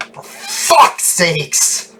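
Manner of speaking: exasperated, high, slow curse; really pissed. Real, not acted. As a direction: explode in frustration, stretching the words. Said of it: "I'm just getting really pissed".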